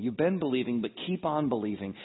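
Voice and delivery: usual speaking voice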